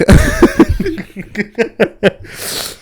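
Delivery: high cackling laugh. Real, not acted. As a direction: burst out laughing. Big laugh at own story.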